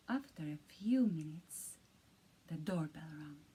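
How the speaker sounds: soft, low suspense whisper; hushed narration. A narrator's reading. Narrator tells the listener in a hushed suspense whisper. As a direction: whisper it like a spooky bedtime story.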